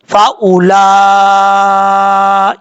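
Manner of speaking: very slow, syllable by syllable recitation; slow Quran recitation. Shown as a demonstration. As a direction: recite it out slowly for the class. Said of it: "tajweed demo of a word". (धीमे-धीमे, हर हिस्सा अलग करके तिलावत)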